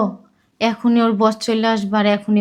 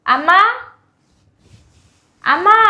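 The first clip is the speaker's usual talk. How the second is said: loud, high, rising call for mother; 9 semitones higher, 4 dB louder, slower than usual. Acted. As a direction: call out for Mum, pitch climbing. Calling mother from another room. (গলা চড়িয়ে মাকে ডাকা)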